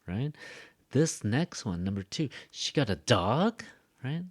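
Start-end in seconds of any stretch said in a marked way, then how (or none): 2.5-3.6 "dog" swoops upward in surprise; surprised question. Shown as a demonstration. Teacher shows sentence number two, surprised rise. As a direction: ask it in disbelief, rising on "dog". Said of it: "I'm, like, surprised now".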